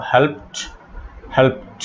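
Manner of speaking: "হেল্পড" said as "helpt"; final -ed as T. Shown as a demonstration. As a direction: close "হেল্পড" on a voiceless t. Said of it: "এটার ইডির উচ্চারণ হবে ট". ("হেল্পড"-এর শেষে ট উচ্চারণ)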